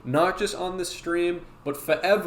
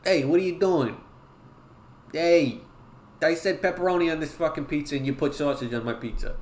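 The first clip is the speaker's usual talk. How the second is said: pizza customer's Brooklyn Italian gripe; Brooklyn Italian accent. Acted. Customer griping about the wrong pizza topping, Brooklyn Italian accent. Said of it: "can you do a Brooklyn Italian accent?".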